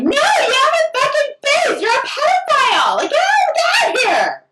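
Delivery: very high, loud screaming; re-enacted furious shout. Protester driving comic off outside club, screaming. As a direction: scream them away from you.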